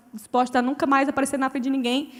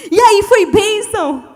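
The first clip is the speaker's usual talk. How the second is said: slow, high, louder cheery question; excited greeting; 5 semitones higher, 7 dB louder, much slower than usual. Retold or imitated. Pastor arriving eager from the conference, excited greeting. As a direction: beam at her like it went great.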